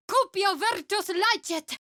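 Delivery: high, forceful Latin spell cry; chanted spell word; spell incantation. Acted. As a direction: hurl the incantation out like a battle cry. Casting a spell in combat.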